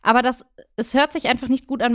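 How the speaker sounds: ordinary talk, nothing marked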